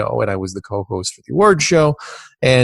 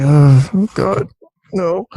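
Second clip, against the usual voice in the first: mock dismay; 6 semitones higher, 5 dB louder, slower than usual. His reply to audience question.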